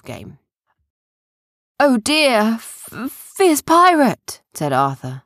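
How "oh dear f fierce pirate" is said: high, loud pretend stammering dread; mock fear. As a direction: act hammed-up scared of the pirate. Playing along with child's pirate game.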